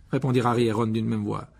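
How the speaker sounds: ordinary delivery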